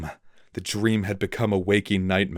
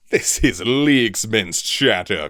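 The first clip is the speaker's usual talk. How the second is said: snorted; 3 semitones higher, 7 dB louder than usual. A narrator's reading. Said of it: "Cloud Wolf snorted".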